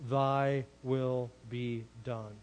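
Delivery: very slow, low 'Thy will be done'; grave final pronouncement. Retold or imitated. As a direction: drop the pitch and space out each word. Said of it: "Or those to whom in the end say".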